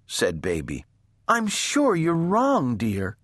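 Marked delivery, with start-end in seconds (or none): 1.3-3.2 high little voice, dropping at the end; gentle little sister. A narrator's reading. Baby gently contradicts her angry sister, high and timid. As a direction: contradict sweetly, pitched high.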